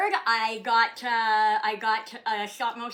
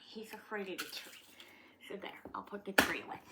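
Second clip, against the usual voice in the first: whisper; 9 dB quieter, faster than usual. Real, not acted. Whispered aside about dog.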